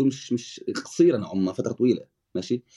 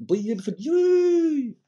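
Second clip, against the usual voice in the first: much higher, very slow stretched word; drawn out; much slower than usual. Real, not acted. Guest searching for his next point, drawn out. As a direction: drag it out while hunting for the word.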